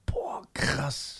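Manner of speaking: breathless whisper of amazement; whispered awe. Real, not acted. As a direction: whisper it, spooked. Listener reacting to creepy story.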